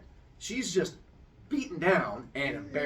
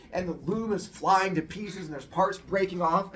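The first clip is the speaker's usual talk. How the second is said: usual speaking voice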